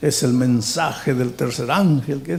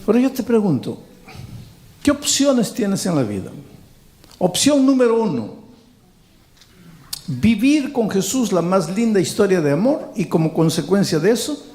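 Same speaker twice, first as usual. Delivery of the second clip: raised-pitch preacher's challenge; preaching appeal; 7 semitones higher than usual. Real, not acted. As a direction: put it to the congregation, voice lifted high.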